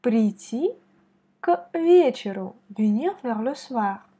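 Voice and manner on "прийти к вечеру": Прийти stressed on the final и, к вечеру stressed on the first е, louder; stress on last I. Shown as a demonstration. Teacher models word stress for student. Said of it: "on met l'accent sur la dernière I".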